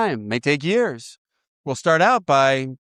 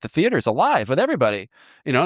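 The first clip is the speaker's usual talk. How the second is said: ordinary talk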